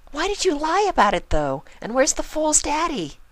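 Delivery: high, quick, serious pony voice; earnest Pinkie. Acted. Pinkie confiding in Spike, high and earnest. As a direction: confide a big secret, high and fast.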